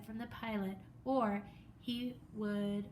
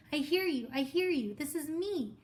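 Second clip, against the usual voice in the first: higher, louder desperate reply; a boy answering the radio voice, louder and higher; excited shouting; 5 semitones higher, 5 dB louder than usual. Acted. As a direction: grab the radio lifeline.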